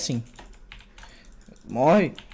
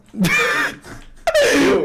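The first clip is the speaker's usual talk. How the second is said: very high squealing laugh; high laughing fit; 3 dB louder than usual. Real, not acted. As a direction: burst out laughing at a friend's jab. Reacts to friend's jab.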